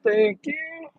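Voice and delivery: squeaky falsetto thanks; high-pitched thank you. Real, not acted. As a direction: chirp it way up high. Thanking someone letting him go.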